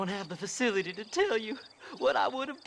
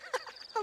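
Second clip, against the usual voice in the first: cackle; 8 dB quieter than usual. Acted. Crazed prospector laughs.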